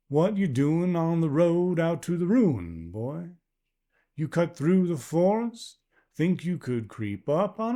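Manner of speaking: put-on a wary stranger's unplaceable accent; strange rural accent. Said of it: "English in an accent he not only couldn't place".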